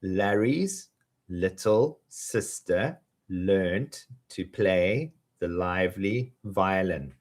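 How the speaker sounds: slow, drawn-out twister; slowly. Shown as a demonstration. Teacher saying it slowly with students. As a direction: stretch each word out. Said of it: "let's say it slowly together".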